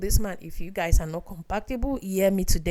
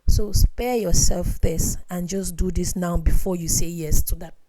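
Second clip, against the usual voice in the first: ordinary delivery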